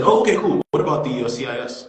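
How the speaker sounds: retold upbeat cover for heartbreak; projecting strength. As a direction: act unbothered and move right on. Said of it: "project strength".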